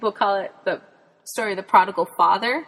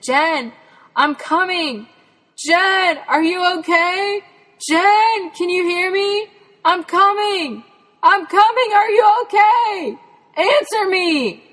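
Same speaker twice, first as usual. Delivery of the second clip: high, loud calling into the brush; 10 semitones higher, 6 dB louder, slower than usual. Retold or imitated. Dad searching the woods for Jen, calling out in a high loud shout. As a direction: shout as if hunting for a lost child.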